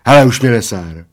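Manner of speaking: loud, irritated outburst; loud, fed-up retort; exclaimed. A narrator's reading. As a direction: call it out in annoyance. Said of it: "zvolal Plešatec".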